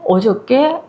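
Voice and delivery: ordinary delivery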